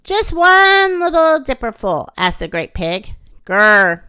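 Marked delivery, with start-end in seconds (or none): 0.1-2.1 high, loud wheedling request; pleading. A narrator's reading. Great Pig asking the Great Bear, pleading. 3.5-4.0 loud, low storybook growl; bear growl. A narrator's reading. Great Bear answering the Great Pig, growling. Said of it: "growled the Great Bear".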